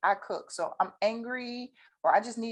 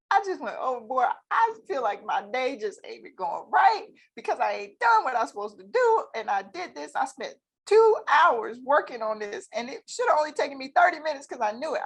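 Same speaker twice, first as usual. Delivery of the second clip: higher, louder venting; frustrated client; 6 semitones higher, 5 dB louder than usual. Retold or imitated. A client calling, lightly voiced frustrated venting. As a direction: vent like a stressed client. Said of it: "called me and said".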